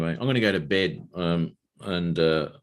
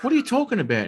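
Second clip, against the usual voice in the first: high-pitched mock bafflement; baffled ranger; much faster than usual. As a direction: play the ranger questioning him, pitched way up. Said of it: "And he goes".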